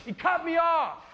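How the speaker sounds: loud, high outraged complaint; road rage. Retold or imitated. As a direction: yell at the driver who cut in. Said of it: "and it's like".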